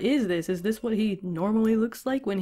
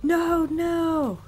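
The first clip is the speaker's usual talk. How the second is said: high, drawn-out cry of protest; wailing plea, pitched up and stretched out; mock desperate shout; 7 semitones higher, much slower than usual. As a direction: imitate someone begging in panic. Victim protesting in retold story.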